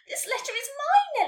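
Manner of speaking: high, loud protest; panicked shout. A narrator's reading. Nelly defending her letter from the unicorn, shouting. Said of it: "Nelly shouted to panic".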